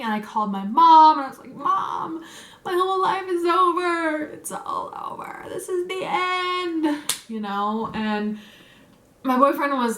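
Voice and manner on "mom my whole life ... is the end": mock bawling. Daughter phoning mom after the theft, mock bawling. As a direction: whine it out as an over-dramatic crying retelling, dropping into breath. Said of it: "because I was bawling".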